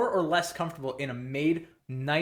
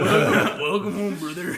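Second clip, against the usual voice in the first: high, slow, louder mock greeting through laughter; laughing trucker quote; 5 semitones higher, 7 dB louder, much slower than usual. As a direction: ham up the trucker welcome while cracking up. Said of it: "Like a southern trucker".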